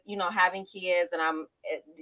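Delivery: ordinary talk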